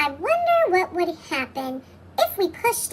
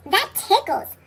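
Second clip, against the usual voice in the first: high, giggly cartoon voice; giggling; 8 semitones higher than usual. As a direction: giggle through it. Tummy scratched, Larry giggles.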